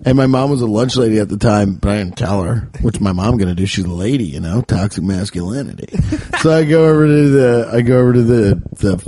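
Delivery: ordinary delivery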